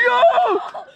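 high, loud whoop of joy; real whoop. Real, not acted. Angler after the catch, whooping high and loud. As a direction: let out a wild yell. Landing the snook.

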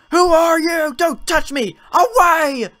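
terrified yelling; mother recoils from the nurse; frightened shout. Acted. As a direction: push them away in panic.

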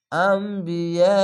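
very slow drawn-out Quranic chant; tajweed recitation demo. Shown as a demonstration. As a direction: stretch every syllable of the word. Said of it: "স্লোভাবে একটু পড়ছি কেন".

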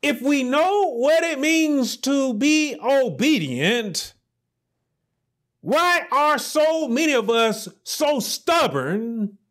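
high, pleading preacher's challenge, slowing on each question; impassioned preaching. Acted. Preacher challenging his congregation on stubbornness, impassioned. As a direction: press the congregation hard, rising up. Preaching builds to full force.

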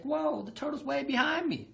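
high, fast, cocky rabbit voice; a lazy show-off rabbit kicking back; brash hare who thinks he's already won; smug rabbit. Retold or imitated. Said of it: "he looked back and he was just like".

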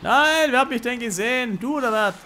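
high, loud panicked yell; alarmed shout. Real, not acted. Spotted by enemy, yelling.

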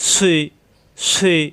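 "সুই" as heavy s gliding to i; heavy letter. Shown as a demonstration. Teacher shows the heavy letter with kasra, rounded "সুই". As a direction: hiss into full-mouthed "সুই". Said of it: "বাতাস বের হবে".

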